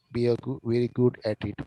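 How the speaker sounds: usual speaking voice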